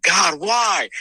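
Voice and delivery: first burst at God, not yet full; frustrated cry to God. Retold or imitated. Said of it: "I was so frustrated".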